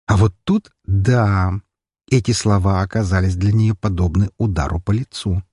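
ordinary delivery, nothing marked

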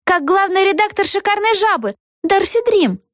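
high-pitched voice chiming in; prompt from the crowd. A narrator's reading. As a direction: chip in helpfully in a high voice. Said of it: "подсказали из толпы".

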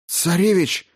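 high, urgent call; alarmed cry. Acted. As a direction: cut in with sudden alarm. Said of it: "прервал его Радько изменившимся голосом".

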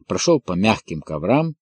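plain reading voice

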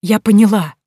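loud, falling "Я поняла" near tears; relieved emphatic. Acted. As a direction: stress "поняла" with joyful relief. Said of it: "что захотелось плакать".

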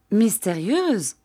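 a lightly acted cry of surprise; exclaiming. A narrator's reading. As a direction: draw the word out in feigned astonishment. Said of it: "s'écria-t-elle".

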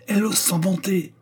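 high-pitched, beside oneself; furious outrage. A narrator's reading. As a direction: exclaim in scandalised fury. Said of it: "furieuse, et ne se possédant plus".

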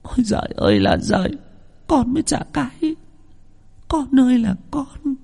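wailing lament. Acted. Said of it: "ngồi khóc lóc".

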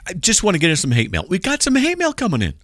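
normal talking voice

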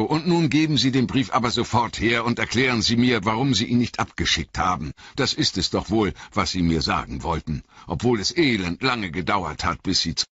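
fast, impatient demand. A narrator's reading. Frodo demanding the letter from the innkeeper, fast and impatient. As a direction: hurry him, you want the letter now.

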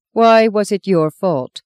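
nun demands an answer, cold and slow. A narrator's reading. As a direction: demand it icily like a strict nun. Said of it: "the nun demanded in the coldest tones".

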